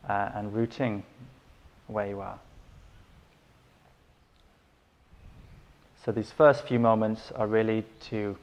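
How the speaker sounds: unhurried meditation guidance with long pauses; guided meditation. Acted. Teacher settling listeners into breath and body, calm guided meditation. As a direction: guide them gently into stillness, leaving space between phrases.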